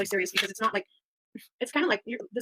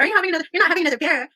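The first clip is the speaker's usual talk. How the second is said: quick, loud, higher nagging voice; nagging girlfriend; 7 semitones higher, 7 dB louder, faster than usual. Retold or imitated. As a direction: scold him fast and shrill. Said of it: "'cause she's like".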